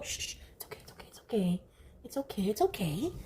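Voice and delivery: soft hushed it's okay, nearly whispered; calm the scared cat in a hush; hushed soothing. Real, not acted. Owner hushing her frightened cat softly.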